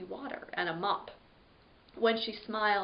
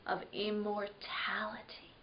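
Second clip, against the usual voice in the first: hushed whisper; 10 dB quieter than usual. A narrator's reading. Spooky hushed storytelling moment.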